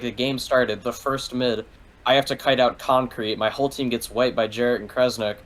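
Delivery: usual speaking voice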